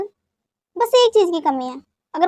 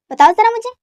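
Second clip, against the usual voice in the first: indignant challenge; 5 semitones higher, 6 dB louder than usual. Acted. Wife challenging husband in quarrel.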